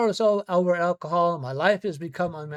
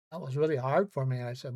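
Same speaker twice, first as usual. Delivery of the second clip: usual speaking voice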